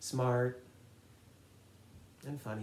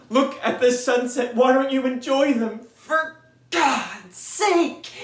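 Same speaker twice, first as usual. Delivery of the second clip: rising to a high, loud exasperated scold; a harsh inner coach scolding herself; self-lecture; 4 semitones higher, 10 dB louder than usual. Retold or imitated. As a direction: lecture yourself, building to a shout. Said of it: "had to say to myself".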